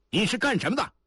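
high-pitched call across a distance; shouted challenge. A narrator's reading. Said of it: "亚丽坤喊话". (高声喊话盘问)

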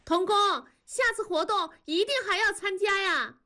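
high, far-carrying call; calling from afar. A narrator's reading. Young woman calling after departing co-worker, high distant call. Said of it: "身后远远地传来了李天骄的声音".

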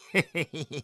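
short sneering chuckle; mocking little snicker; cold laugh. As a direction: laugh coldly at your father. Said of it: "狄景辉冷笑道".

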